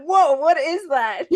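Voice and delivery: high, loud, drawn-out amazement; amazed. Retold or imitated. Friend recalls first hearing her Spanish, amazed and loud. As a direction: gasp it out like hearing something strange. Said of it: "I was like".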